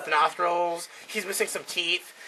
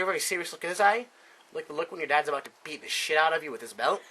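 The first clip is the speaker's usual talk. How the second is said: usual speaking voice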